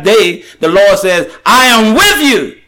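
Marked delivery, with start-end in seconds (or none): 1.4-2.6 raised high and ringing, proclaiming; the Lord's promise called out high and strong; booming divine voice. Acted. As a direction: boom it out as the Lord speaking. Preacher quotes the Lord.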